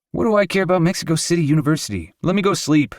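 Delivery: high, fast, mock-whiny protest; a sleepy man begging to be left alone; grumpy protest. A narrator's reading.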